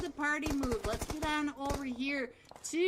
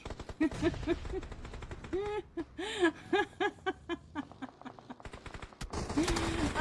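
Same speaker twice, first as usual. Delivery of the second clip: higher-pitched helpless laughter; long laughing fit; 6 semitones higher than usual. Real, not acted. Caster cracking up at his viewers' jokes, long laughing fit.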